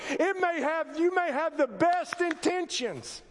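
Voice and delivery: a pastor raising his voice to shake the congregation; preaching at full force. Acted. A pastor warning his church against judgment, preaching at full force.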